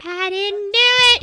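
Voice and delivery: high, pleading denial; whiny mock denial. Gamer pretending to deny blame, high and whiny. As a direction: whine like a guilty kid. Mock protesting innocence to police.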